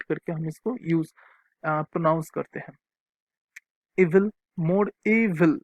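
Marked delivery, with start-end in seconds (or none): 4.0-5.5 slow dictation. Shown as a demonstration. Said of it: "teacher dictates word forms".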